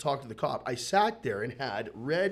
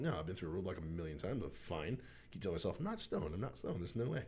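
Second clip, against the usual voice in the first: soft, low inner monologue; hushed, acted-out convincing of oneself; quiet self-reassurance; 9 semitones lower, 11 dB quieter than usual. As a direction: talk yourself down under your breath. Retold inner reassurance facing cop.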